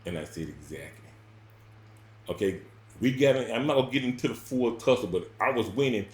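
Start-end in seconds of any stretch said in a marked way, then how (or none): 0.5-1.1 very soft, low word; quiet, low one-word answer; hushed agreement. Retold or imitated. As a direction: drop it almost silent. Curt reply in argument.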